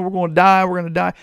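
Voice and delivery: hammed-up fearful whine; mock complaining. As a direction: mimic grumblers crying doom. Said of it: "These people are complaining".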